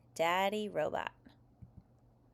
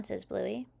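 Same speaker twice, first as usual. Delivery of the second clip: ordinary delivery, nothing marked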